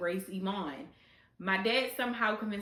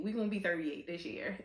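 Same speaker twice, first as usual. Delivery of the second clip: ordinary talk, nothing marked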